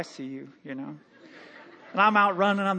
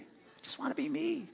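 much softer, nearly breathed repeat; soft echo; 6 semitones higher, 11 dB quieter than usual. Shown as a demonstration. Pastor quietly repeating his wish to congregation, hushed. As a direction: let it fall to a private hush.

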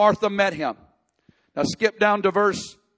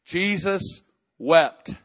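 slow, solemn reading; much slower than usual. Acted. Reads the shortest verse.

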